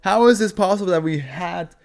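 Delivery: high, loud, slowed disbelief; marvelling at a third beheading SCP, high and loud; amazed disbelief. Real, not acted. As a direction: stretch it out, baffled and amazed. Disbelief at decapitation SCPs.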